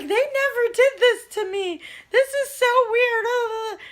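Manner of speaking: very high, loud mock wail; hammed-up impression of a panicked relative; freaked-out mother-in-law. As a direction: whine it in comic horror, stretching the last word. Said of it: "freaked out".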